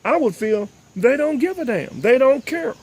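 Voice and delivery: high, heated indignation; indignant. Real, not acted. Host voicing the drivers' hurt, indignant. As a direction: let them hear the outrage.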